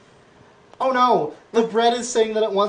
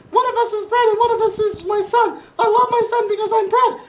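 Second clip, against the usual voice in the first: much higher, cartoonish bread voice, fast in places; shouting bread; 5 dB louder than usual. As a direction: proclaim it like a frantic loaf father. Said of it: "and the bread keeps shouting".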